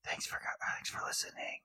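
very soft whispered sign-off; hushed, voiceless murmur of thanks. Pretend. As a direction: whisper it right up close. Teasing whispered sign-off to co-host.

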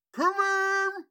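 high loud call; old prospector. Acted. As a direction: bellow through the door. NPC calls through door.

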